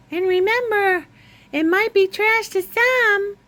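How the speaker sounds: very high, stretched-out farewell; closing her vlog in a high sing-song; sing-song sign-off. Acted. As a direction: sign off up high like a cheery host.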